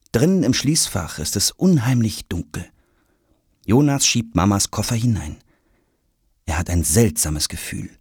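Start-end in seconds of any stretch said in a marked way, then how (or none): none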